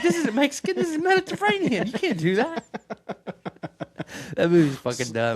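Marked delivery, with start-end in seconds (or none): 0.0-2.6 very high, louder squeaky voice; imitating the cook. Retelling the kitchen scene, very high and squeaky. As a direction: scold it like a cartoon chef. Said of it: "or he's like".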